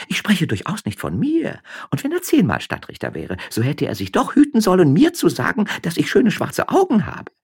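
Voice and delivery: high, quick gossiping woman; peasant wife voice. A narrator's reading. Josefa gossiping to her husband on the donkey, high chatter. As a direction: pitch up into a chattering farm wife.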